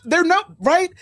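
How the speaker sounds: ordinary talk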